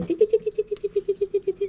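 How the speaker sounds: high squeaky squirrel chatter imitation. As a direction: chirp ti ti ti fast like a squirrel. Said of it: "Puis qui fait". (imitation aiguë du cri d'écureuil, ti ti ti)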